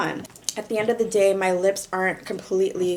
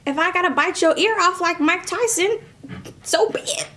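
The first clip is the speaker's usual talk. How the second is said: excited; 9 semitones higher than usual. Real, not acted. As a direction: hype it up like a crazy fighter. Crazy fighting boast.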